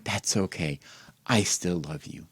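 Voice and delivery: ordinary delivery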